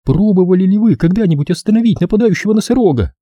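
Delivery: high-pitched woman's desperate question; exasperated woman, pitched far up; despairing exclamation. A narrator's reading. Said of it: "воскликнула Делла в отчаянии".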